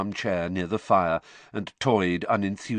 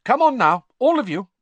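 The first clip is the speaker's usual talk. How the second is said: much higher and louder call; calling them off; 10 dB louder than usual. A narrator's reading. Mother loudly ending the argument at the table. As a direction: herd everyone upstairs firmly.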